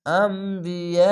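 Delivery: very slow drawn-out Quranic chant; tajweed recitation demo. Shown as a demonstration. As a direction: stretch every syllable of the word. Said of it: "স্লোভাবে একটু পড়ছি কেন".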